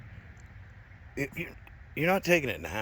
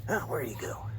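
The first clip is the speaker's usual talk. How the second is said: normal talking voice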